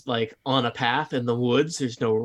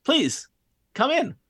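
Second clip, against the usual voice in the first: lightly voiced young man, higher pitch; 8 semitones higher, 3 dB louder than usual. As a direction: lift the voice into a welcoming greeter. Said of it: "a young man".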